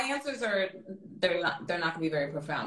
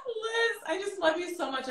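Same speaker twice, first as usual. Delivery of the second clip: tearful laugh. Real, not acted. Tearful laugh while telling her costar she loves her. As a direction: laugh breaking into tears, pitched much higher.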